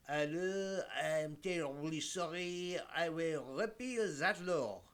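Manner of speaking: slow, hammed-up French accent; terrible French accent. French premier backs down on his law, terrible French accent. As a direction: badly fake a French accent, dragging the words out. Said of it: "in my best terrible accent".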